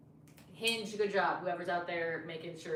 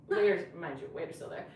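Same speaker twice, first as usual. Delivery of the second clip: usual speaking voice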